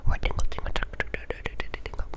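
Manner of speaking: rhythmic whispered trigger repetition; crisp repeated whispered triggers; asmr trigger words. Acted.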